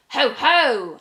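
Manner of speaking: loud sly chuckle of glee; gloating. Acted. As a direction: relish the mischief. Witch gloating before spell.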